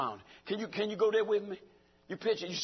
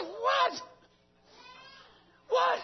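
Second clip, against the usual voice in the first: shrill mock shock, much higher and shouted; high shouted what, what; astonished. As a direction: blurt it out in stunned disbelief. Preacher's astonished reply retold.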